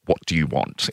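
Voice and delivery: low, unimpressed demand; Princess Anne, sour. Retold or imitated. Imitating a sour royal facing the microphone, unimpressed. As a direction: curtly put out, voice dropped. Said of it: "her whole demeanor just screams".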